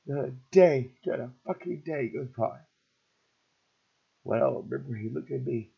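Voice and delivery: ordinary talk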